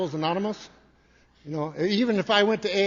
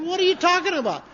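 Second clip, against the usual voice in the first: baffled protest; 12 semitones higher, 7 dB louder than usual. Retold or imitated. Retold argument with laughing sister.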